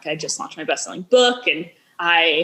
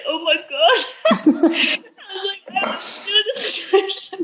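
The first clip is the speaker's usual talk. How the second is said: high, laughing, half-stunned; laughing disbelief; 13 semitones higher, slower than usual. Retold or imitated. Recalling her friend saying she's depressed, laughing in disbelief. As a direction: laugh it off in shock.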